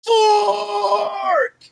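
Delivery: high, drawn-out shout of FORK; triumphant scream. Acted. Player acts leaving the tent fist in air, screaming. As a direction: scream it long like a battle cry. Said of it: "and scream".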